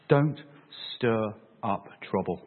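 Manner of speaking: grave, spaced-out caution; hushed deliberate warning. Acted. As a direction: let each word sink in, voice dropping. Repeats Paul's warning, dropping lower.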